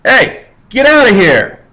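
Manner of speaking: teacher playing a guard chasing off the class, mock shout. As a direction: warn off the peasants like a guard. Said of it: "I'll be the guards".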